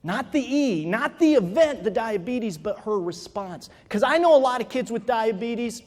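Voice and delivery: ordinary talk, nothing marked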